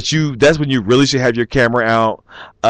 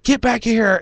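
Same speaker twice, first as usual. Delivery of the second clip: mock demand; 10 semitones higher than usual. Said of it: "And he's like".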